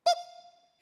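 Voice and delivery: sudden high squeaky boop; a tiny triumphant yelp as he tags me; catcher's boop. Retold or imitated. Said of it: "he just goes".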